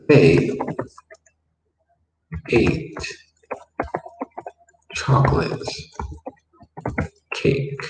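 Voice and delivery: "They ... ate ... chocolate ... cake" with long pauses between words. Shown as a demonstration. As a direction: dictate it one word at a time. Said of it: "translate this one".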